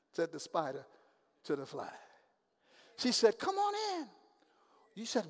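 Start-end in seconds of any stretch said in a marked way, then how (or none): 3.4-4.1 high, sweet luring call; coaxing seductive invitation. Acted. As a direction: lure him in sweetly. Seductive woman voiced.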